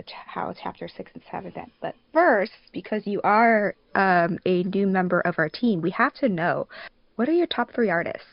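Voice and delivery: usual speaking voice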